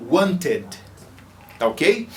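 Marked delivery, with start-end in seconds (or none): 0.1-0.7 "wanted" with a clear "ed" syllable, want-ed; full ed. Shown as a demonstration. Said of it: "E want fica".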